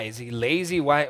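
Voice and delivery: ordinary talk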